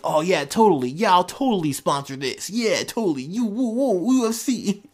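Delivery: high, hyped-up promoter whooping. Retold or imitated. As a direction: sell the fight like a promoter.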